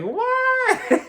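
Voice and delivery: drawn-out, very high squealed disbelief; incredulous. Acted. Mock disbelief at teacher's remark.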